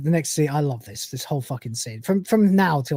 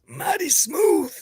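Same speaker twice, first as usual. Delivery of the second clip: very high, slow squeak; strained mock voice; 12 semitones higher, much slower than usual. Lister reacts to throwing back his shot, high strained voice. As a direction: squeeze it out high and drawn-out. Acting Lister after downing whiskey.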